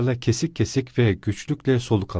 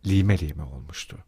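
ordinary delivery, nothing marked

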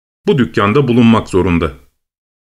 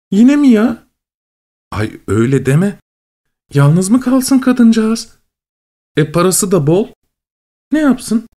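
lightly voiced mother and daughter; voiced phone chat; 4 semitones higher than usual. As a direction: act a chatty phone call.

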